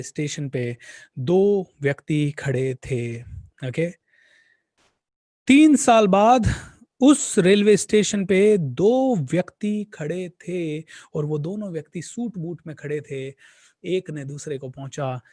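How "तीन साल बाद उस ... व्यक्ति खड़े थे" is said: slow, louder and higher story beat; dramatic retelling. Shown as a demonstration. Speaker re-telling the time jump to his audience, slow and louder. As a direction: stretch it out for suspense. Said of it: "storyteller re-says line".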